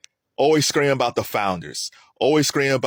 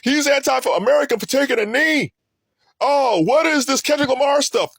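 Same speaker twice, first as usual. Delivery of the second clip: higher, mocking the outraged critics; mock outrage; 10 semitones higher than usual. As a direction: sneer it like an offended pundit. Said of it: "All that outrage about".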